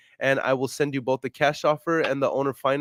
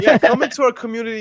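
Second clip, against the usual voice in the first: loud, fast, high mock welcome; excited; 7 semitones higher, 7 dB louder, faster than usual. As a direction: hype them in fast.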